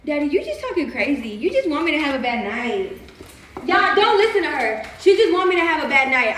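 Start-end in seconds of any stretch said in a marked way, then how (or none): 3.7-6.4 higher, louder public appeal; calling to crowd. Real, not acted. Woman telling the crowd to ignore her partner, loud appeal. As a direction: call it out over the partner's words to everyone.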